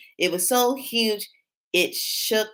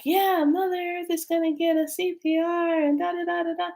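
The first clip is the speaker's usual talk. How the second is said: much higher, otherworldly voice rattling on; voice from beyond; 11 semitones higher, faster than usual. Retold or imitated. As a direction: sound like a spirit reciting the message. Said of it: "it's like".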